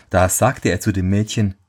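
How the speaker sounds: ordinary delivery, nothing marked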